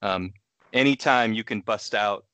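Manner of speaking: normal talking voice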